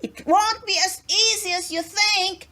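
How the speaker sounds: high called-out warning; an elf shouting a warning down the road; calling after. A narrator's reading. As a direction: call after the departing cart. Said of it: "heard the elf call after him".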